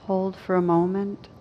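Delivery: ordinary talk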